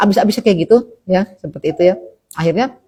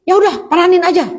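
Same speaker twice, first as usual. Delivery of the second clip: higher-pitched mock impatience; husband's dismissive reply; 7 semitones higher than usual. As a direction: act out a husband brushing it off. Voices husband's curt reply.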